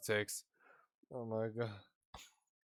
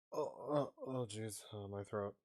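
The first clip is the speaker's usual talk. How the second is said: ordinary talk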